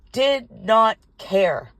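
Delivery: "Did not care" very slow, word by word; indignant emphasis. Real, not acted. Woman bitten by the dog about its owner, indignant emphasis.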